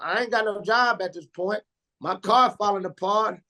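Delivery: ordinary talk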